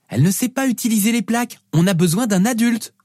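put-on high-pitched, insistent child; little girl arguing. Daughter needing an adult for pancakes, high insistent child voice. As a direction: argue back in a small girl's voice.

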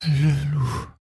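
put-on very high voice of a wounded girl; high, weakening girl's voice; gasping, failing voice. A narrator's reading. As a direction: pant it out, strength ebbing. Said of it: "halta-t-elle d'une voix qui faiblissait".

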